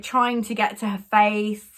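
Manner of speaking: ordinary talk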